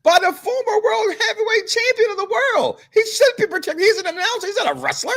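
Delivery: very high-pitched mock protest; mock outrage. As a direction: plead the announcer's case in a high, hammed-up voice. Mock outrage over attacked announcer.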